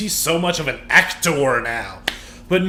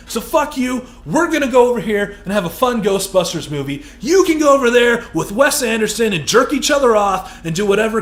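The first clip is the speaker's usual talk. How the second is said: much higher and louder, shouting with rage; furious shout; 4 semitones higher, 4 dB louder than usual. Real, not acted. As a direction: yell it right at him. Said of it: "where did that come from?".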